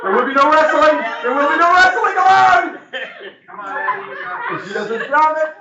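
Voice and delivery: high, hammed-up race announcer. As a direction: call it like a big finale. Hyped mock race announcing.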